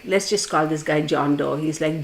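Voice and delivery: normal talking voice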